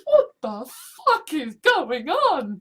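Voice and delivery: slow, loud, drawn-out bafflement; baffled. Retold or imitated. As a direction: stretch out the confusion loudly. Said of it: "and goes".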